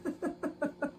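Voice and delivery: soft laugh. Real, not acted. Laughing while sewing, apologizes.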